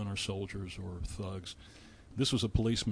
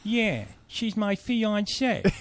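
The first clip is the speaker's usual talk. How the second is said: higher, slow, drawn-out Vinny voice; Vinny's reply; 10 semitones higher, 4 dB louder, much slower than usual. Retold or imitated. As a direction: stretch out fiancée like a sheepish New Yorker. Vinny answers the judge.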